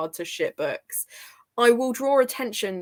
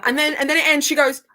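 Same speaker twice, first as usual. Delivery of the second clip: ordinary talk, nothing marked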